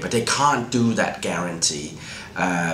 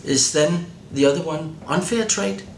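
puzzled questioning, pitched higher; curious; 8 semitones higher than usual. Retold or imitated. Journalist recalling the question that started the research, curious. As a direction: ask it with raised, inquisitive pitch. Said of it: "I was just curious".

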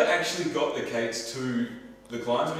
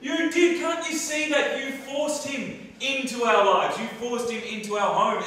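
loud, higher, furious blame; accusing a lover in a fight; angry accusation; 7 semitones higher, 8 dB louder, slower than usual. Acted. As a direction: hurl the blame at him. Heated breakup fight, accusing.

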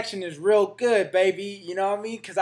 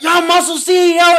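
much higher and louder fan voice; excited fan call; 9 semitones higher, 10 dB louder than usual. Retold or imitated. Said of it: "And he was like".

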